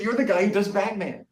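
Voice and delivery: high, excited fan voice; the architect recognizing him. Retold or imitated. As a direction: squeal it out like a starstruck fan. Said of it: "He said".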